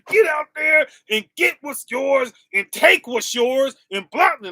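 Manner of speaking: loud mock hype-man preaching. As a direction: ham up the hustle advice. Mocking worldly go-getter advice.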